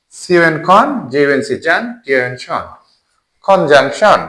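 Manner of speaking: rhythmic letter names, each followed by its syllable; spelling by syllable. Shown as a demonstration. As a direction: spell each chunk, then join as কনজাংশন. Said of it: "syllable করে".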